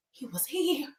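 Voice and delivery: softly acted haunted statement; shaken hush. Host as shaken Liam telling Bill, mock hushed fear. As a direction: say it small and hushed. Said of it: "Liam was really shaken".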